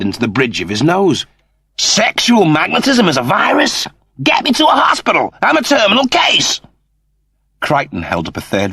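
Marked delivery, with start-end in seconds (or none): 1.8-6.6 high, fast, vain Cat in mock panic; the Cat squealing he's doomed, hammed up. Cat voiced in read story.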